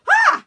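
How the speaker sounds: very high, loud mock shriek of alarm; startled scream. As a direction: yelp as if a giant just bolted past. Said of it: "And I'm like".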